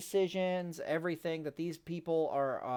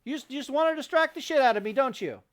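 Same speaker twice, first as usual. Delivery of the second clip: loud, higher, fast exasperated scolding; annoyed yelling; 9 semitones higher, 7 dB louder than usual. Real, not acted. Vlogger scolding his distracting wife, annoyed. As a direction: let her have it, half joking.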